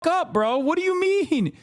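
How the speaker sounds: outraged retort. Real, not acted. Streamer shouting down his cohost's take, outraged. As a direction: snap back in disbelief at the cohost.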